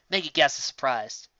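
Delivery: usual speaking voice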